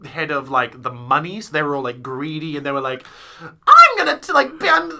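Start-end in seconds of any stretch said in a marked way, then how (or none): 3.4-5.0 very high, loud spooky witch voice; cackling witch squeal; witchy voice. Retold or imitated. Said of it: "evil and spooky and witchy".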